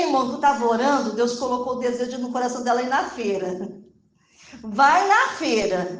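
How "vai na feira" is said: slow, slightly raised command; acted command. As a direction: voice a drawn-out, insistent order. God's command voiced in the story. (ordem encenada, lenta e insistente)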